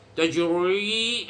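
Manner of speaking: Tajri said with a heavy, thick r; wrongly thickened. Shown as a demonstration. Teacher showing students the wrong heavy reading. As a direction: overdo the r in Tajri on purpose. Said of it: "nggak bagus".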